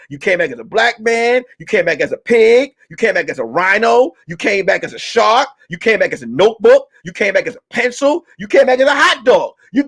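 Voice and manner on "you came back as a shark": ordinary talk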